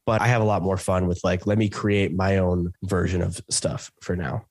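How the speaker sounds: ordinary talk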